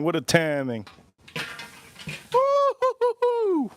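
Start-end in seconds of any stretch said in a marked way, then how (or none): 2.3-3.7 very high, slow hooting like a monkey; monkey hoot imitation. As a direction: call back to the monkey with drawn-out hoots.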